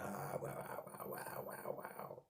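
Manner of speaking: breathy whispered wows, barely audible; a hushed, amazed string of wows; awed whisper. Retold or imitated. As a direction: whisper it like you're stunned. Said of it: "usually I'm like".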